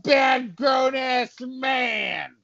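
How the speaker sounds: high, tearful cartoon cop; high-pitched crybaby policeman; wailing cop. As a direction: blubber in protest, drawn out. Cop wails back at insult.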